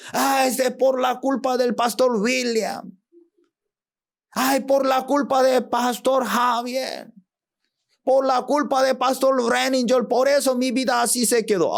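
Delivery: high, whining complaint; whining blame. Acted. Churchgoer blaming the church and pastor, high and whining.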